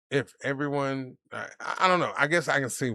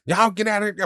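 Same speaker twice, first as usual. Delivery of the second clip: higher, louder quoted command; imitated shooing order; 8 semitones higher, 6 dB louder than usual. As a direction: act out a shop owner shooing people off. Acts out bakery woman's order.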